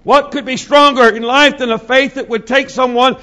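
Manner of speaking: loud, high, shouting sermon climax; preacher thundering about Pentecost, loud and high; preaching at full force. Acted. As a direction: whip the congregation up, full throat.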